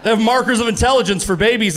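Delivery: usual speaking voice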